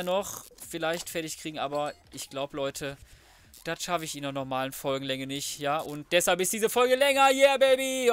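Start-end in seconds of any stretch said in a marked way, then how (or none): 6.1-8.1 loud, high hype shout; excited cheer. Real, not acted. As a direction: cheer it like a win. Announces longer episode, cheering. (laut und hoch gejubelt)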